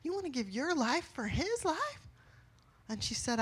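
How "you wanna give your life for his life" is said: much higher, disbelieving question; father asking his daughter in disbelief; incredulous. Retold or imitated. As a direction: ask it stunned and high. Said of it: "I would be like".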